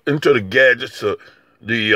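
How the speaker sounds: normal talking voice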